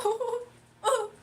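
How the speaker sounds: high hammed-up whimper; mock crying. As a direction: fake a teary ooh, half joking. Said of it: "and I'll just start crying".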